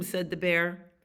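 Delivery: ordinary delivery, nothing marked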